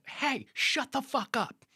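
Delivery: high, forceful mock yell, drawn out; retold angry shout. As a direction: act out the coach yelling at his player. Retelling an intense coach snapping at player.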